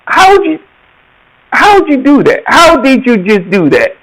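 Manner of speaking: high, incredulous questioning; mock amazed onlooker; amazed disbelief. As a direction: act baffled at what you just saw. People amazed at his skill.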